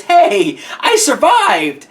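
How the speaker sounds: loud, drawn-out, high-pitched mock greeting; mock cheerful shout. Movie survivor hailing the heroine at the end, hammed-up shout. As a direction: call it out big, hammed-up and triumphant. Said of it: "drives up next to her and says".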